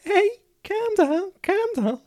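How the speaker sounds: very high squeaky Scouse caricature, shouted; mock Scouser "calm down". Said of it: "I am a cheeky little Scouser".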